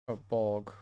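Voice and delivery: Bolg in a put-on fantasy accent with a rolled, darker vowel. Shown as a demonstration. Narrator experimenting on the goblin's name, in mock accent. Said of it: "why am I trying to say this with an accent".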